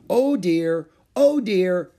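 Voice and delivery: high, loud two-chord harmonica wheeze; harmonica imitation. A narrator's reading. Ramona puffing into harmonica on her tricycle, loud high imitation. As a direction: honk it in and out like a harmonica. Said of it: "she inhaled and exhaled".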